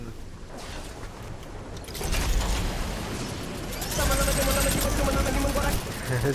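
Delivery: high, playful nonsense chanting; gibberish sing-song. Real, not acted. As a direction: babble a silly tune. Playful gibberish while laughing.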